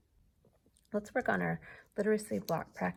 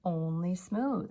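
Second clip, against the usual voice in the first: slow emphasis; 4 dB louder than usual. Shown as a demonstration. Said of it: "teacher instruction, slowed".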